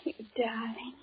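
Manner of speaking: tender, nearly voiceless whisper to her boss; hushed lover's whisper, barely audible. Acted. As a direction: breathe the confession softly. Secretary whispers love to boss.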